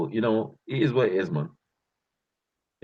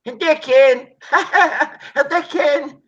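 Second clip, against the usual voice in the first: laughing Hadouken; 6 dB louder, slower than usual. Retold or imitated. Kids chanting Hadouken, laughing.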